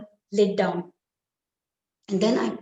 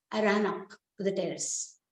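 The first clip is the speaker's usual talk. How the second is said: plain reading voice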